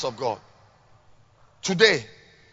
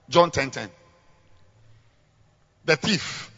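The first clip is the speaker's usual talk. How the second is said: normal talking voice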